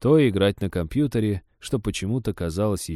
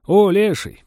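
loud high hail across the table; cheerful loud greeting; 11 semitones higher, 8 dB louder, slower than usual. Acted. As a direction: call out to a friend. Friend calling out surprised.

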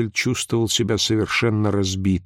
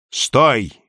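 louder, higher one-word order; a narrator's restrained shout; shouted command; 4 semitones higher, 5 dB louder than usual. A narrator's reading. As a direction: call out a halt, moderately loud. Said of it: "крикнул он".

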